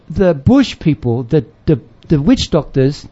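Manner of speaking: ordinary delivery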